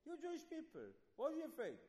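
preacher voicing an incredulous rebuke to the spies; incredulous challenge. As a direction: demand it in high disbelief.

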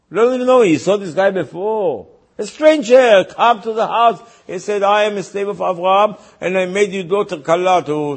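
loud, high-pitched exasperation; indignant protest. Acted. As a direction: act out a father loudly disputing a stranger's story. Outraged father recounts the stranger's claim.

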